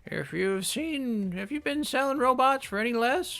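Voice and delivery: put-on high, slow old woman voice; a knowing old lady explaining prices. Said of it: "we can do an old lady voice".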